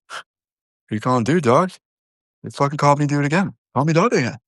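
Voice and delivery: indignant comeback. Acted. Customer snapping back at the worker, mock indignant and high. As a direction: act offended at being called dude. Acted quarrel over dude and dog.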